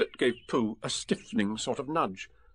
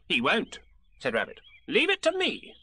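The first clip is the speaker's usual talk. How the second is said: high, bossy little rabbit voice; fussy Rabbit; 6 semitones higher than usual. Acted. Rabbit pressing Piglet to agree, bossy and high. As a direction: lay out the plan like a busy organiser.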